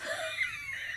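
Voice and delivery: very high shrieking burst of laughter; real high laugh shrieking out. Real, not acted. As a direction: let out a big shrieking laugh. Laughs at the memory.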